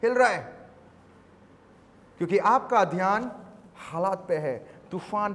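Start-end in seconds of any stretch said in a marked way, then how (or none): 0.0-0.6 high, loud pulpit call; full-force sermon voice; fiery preaching. Acted. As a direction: drive the point home to the crowd.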